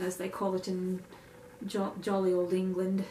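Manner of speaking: a hint of a fake English accent, lightly done; clumsy mock English accent, barely put on; bad English accent. Shown as a demonstration. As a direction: toss in a half-hearted English accent. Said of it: "worst English accent I've ever done".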